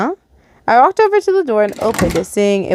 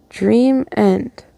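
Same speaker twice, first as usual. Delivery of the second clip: plain reading voice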